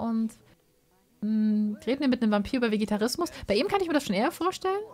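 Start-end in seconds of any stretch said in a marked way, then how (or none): none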